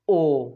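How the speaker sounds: ओ as a long "oh"; Spanish O vowel. Shown as a demonstration. Teacher models fourth vowel, long isolated ओ. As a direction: hold ओ alone, as in no. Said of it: "इसको pronounce करना है आपने ओ".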